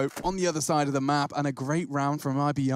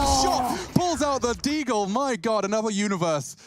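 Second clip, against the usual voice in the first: excited shouting play-by-play; esports caster; 10 semitones higher, 4 dB louder than usual. Real, not acted. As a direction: yell it like a caster hyping a clutch. Caster hype over big play.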